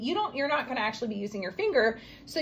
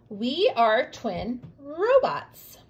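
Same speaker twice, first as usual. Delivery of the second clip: slow reading aloud; much slower than usual. Shown as a demonstration. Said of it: "start with R for robots".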